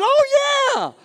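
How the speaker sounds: loud, very high mock cry of recognition; hammed-up shout of sudden understanding; excited realisation. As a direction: burst out as if it finally clicks. Retold listener suddenly understands oil.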